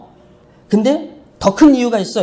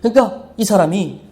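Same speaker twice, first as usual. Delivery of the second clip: usual speaking voice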